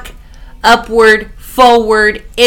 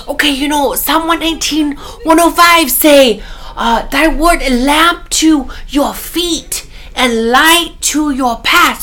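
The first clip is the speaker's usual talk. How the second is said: a loving imitation of a Korean-accented mother quoting scripture; Korean mom accent; 6 semitones higher than usual. Son imitates his Korean mom reciting a Psalm, in her accent. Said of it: "she says in her accent".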